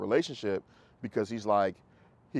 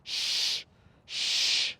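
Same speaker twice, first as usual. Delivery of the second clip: shushing sound. Shown as a demonstration. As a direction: hush the dog with a long shh. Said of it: "then I'm gonna go".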